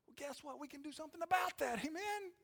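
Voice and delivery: very high-pitched answer, climbing to the end; playful high voice. Acted. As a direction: answer the complainer up in their own high register.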